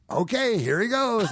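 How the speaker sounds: high, chirpy handover; imitated host's send-off. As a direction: hand over the stage in a mock-host voice. Host handing back the stage.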